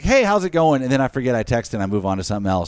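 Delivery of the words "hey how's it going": a lightly voiced cheery hello, louder; breezy greeting. Texting a friend before forgetting, mock cheery. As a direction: toss it off like a friendly text. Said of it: "Like, I like".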